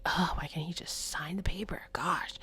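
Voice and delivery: annoyed muttering under the breath; irritated whisper. Retold or imitated. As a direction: grumble it in a whisper so dad can't hear. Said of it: "I'm irritated".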